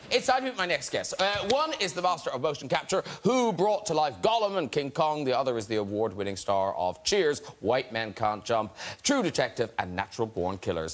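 announcer patter building to a big finish; show host's introduction. Real, not acted. Host welcomes star guests onstage, rousing announcer delivery. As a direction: build up to the guests' names, then call them out.

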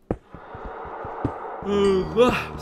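long rising yawn; big yawn. Real, not acted. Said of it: "Sorry, I had to yawn".

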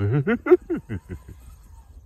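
bright laugh at himself; amused laugh. Real, not acted. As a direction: burst out laughing. Laughs at own drama.

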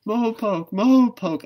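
high, loud mock bird call; mocking nightjar call. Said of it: "she continued".

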